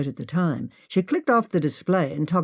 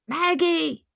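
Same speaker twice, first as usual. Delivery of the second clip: distant call; 12 semitones higher than usual. Acted. Henry calling down from far away, faint and high. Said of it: "Henry's voice sounded miles away".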